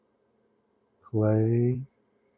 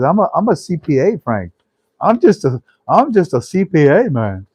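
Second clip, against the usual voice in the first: raised, loud, amused protest; imitating cracked-up caller; 6 semitones higher, 5 dB louder than usual. Caller telling the host who he is, imitated as cracking up. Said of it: "The guy's cracking up".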